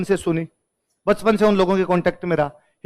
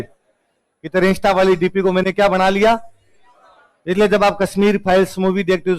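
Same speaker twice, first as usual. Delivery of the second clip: ordinary talk, nothing marked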